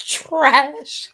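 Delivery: drawn-out, high, loud TRASH; dramatic disgusted verdict. Real, not acted. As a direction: pronounce it trash with relish. Blunt dramatic verdict on artwork.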